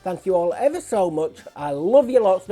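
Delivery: normal talking voice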